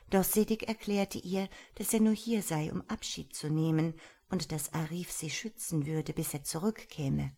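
ordinary delivery

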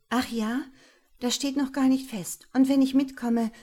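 high, loud prickly retort. Acted. Laura rebuffing Jerome's invitation, prickly and loud. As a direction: snap back to keep him at a distance. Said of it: "gab die hübsche Frau kratzbürstig zur Antwort".

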